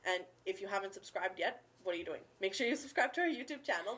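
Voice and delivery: ordinary talk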